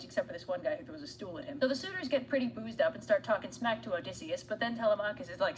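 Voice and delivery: ordinary delivery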